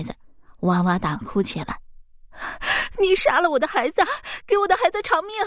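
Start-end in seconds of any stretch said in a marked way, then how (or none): 3.0-5.3 furious shriek. A narrator's reading. Grieving fox mother shrieks at Zhang Huai, furious and high. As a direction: scream at him, demanding a life for a life. Mother accuses him of killing child.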